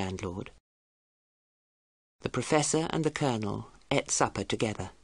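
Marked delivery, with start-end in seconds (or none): none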